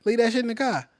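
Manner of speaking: put-on Chicago accent. Imitating Chicagoans talking about a car, Chicago accent. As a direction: put on Chicago, dropping the final R. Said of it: "they say car".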